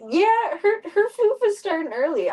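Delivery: high, loud gushing; excited. Real, not acted. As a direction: burst out delighted.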